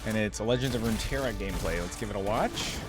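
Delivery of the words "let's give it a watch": ordinary talk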